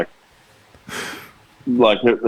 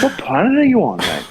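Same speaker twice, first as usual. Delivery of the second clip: exasperated disbelief; 9 semitones higher than usual. Real, not acted. Ranting to his co-host about team selection, high incredulous outrage. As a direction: pitch it up high and drag it out in outrage.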